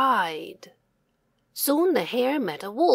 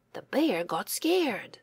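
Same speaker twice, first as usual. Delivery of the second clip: ordinary delivery, nothing marked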